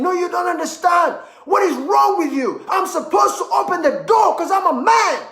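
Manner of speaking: high, loud mock shout at the wife; a hammed-up indignant husband shouting; mock angry argument. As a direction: yell it like a stubborn husband who won't back down. Husband arguing, mocked.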